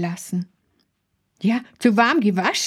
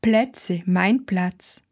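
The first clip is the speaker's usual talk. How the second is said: usual speaking voice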